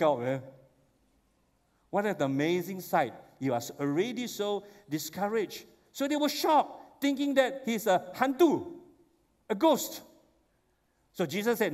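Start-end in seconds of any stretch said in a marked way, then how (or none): 5.9-10.0 shocked. Real, not acted. Preacher acting the disciples' shock.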